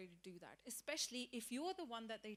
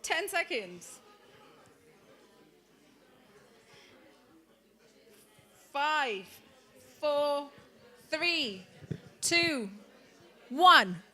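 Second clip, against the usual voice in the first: counting down, louder and louder to the final one; loud countdown; 4 semitones higher, 17 dB louder, much slower than usual. Real, not acted. Teacher counting down the pair task for the class, loud call. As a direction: shout the numbers over the chatter. Countdown over noisy room.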